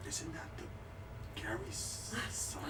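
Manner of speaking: unvoiced, slow whispered gossip. Townsfolk gossiping about the noble's son, slow whisper. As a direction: whisper it behind a hand to a neighbor.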